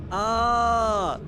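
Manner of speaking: high, loud cry of frustration; a sudden pained yell; frustrated shout. Real, not acted. Frustrated after a failed run.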